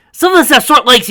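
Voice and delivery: very high, loud grumble; winded complaint. A narrator's reading. Panting halfling complaining to elf, loud and high. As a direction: gripe after the chase.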